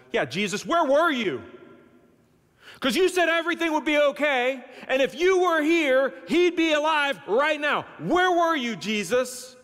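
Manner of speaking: high, heated accusation; unhappy Martha. Acted. Martha confronting Jesus over her brother's death, in high, upset reproach. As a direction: demand an answer, pitch climbing high. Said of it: "she's not happy".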